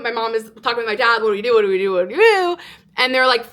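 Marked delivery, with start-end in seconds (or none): 1.2-2.6 mock panic climbing at the end; retold frantic looping question; freaking-out parents. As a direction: imitate parents fretting, last repeat pitched up. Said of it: "freaking out".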